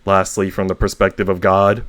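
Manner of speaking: lively read-aloud of a comic book; expressive narration, each speaker a little different; story reading. A narrator's reading. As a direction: read it like a storyteller performing for viewers.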